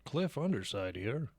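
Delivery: slow anchorman sign-on; news anchor voice. Pretend. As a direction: announce it like a TV newsreader. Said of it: "Sounds like a fucking news anchor".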